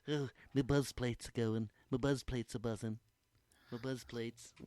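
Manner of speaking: stoned friend mumbling. Retold or imitated. Host imitating his stoned friend, dazed and fading. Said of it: "he's like".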